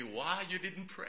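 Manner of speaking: soft, gentle divine question; voice of the Lord. Retold or imitated. The Lord gently asking the preacher, softly. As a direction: ask it quietly, as God would. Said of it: "like the Lord said to me".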